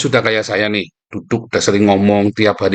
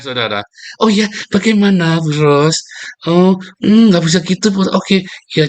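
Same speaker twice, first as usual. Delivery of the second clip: sweet-talking man; 6 semitones higher than usual. Retold or imitated. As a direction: coo at Bu Ros like a smooth charmer. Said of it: "tak jamin nggak pernah ngomong selembut kayak tadi".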